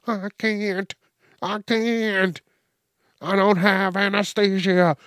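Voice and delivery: high, panicky pleading; high mock panicked plea; pleading patient. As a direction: beg to keep the lung. Miss Jenkins pleads.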